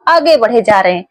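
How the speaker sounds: ordinary delivery, nothing marked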